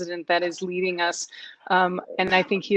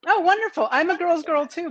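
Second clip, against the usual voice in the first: delighted; 9 semitones higher, 3 dB louder than usual. Real, not acted. Delighted reply, high pitch.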